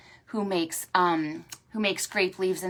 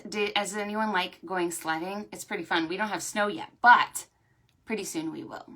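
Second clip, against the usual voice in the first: normal talking voice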